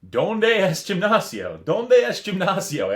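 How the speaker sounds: slow, high, loud tourist asking in Spanish; drawn-out ¿Dónde es gimnasio? in clumsy tourist Spanish. Retold or imitated. Tourist asking hotel workers for the gym in Spanish. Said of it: "the little Spanish ... walking around saying".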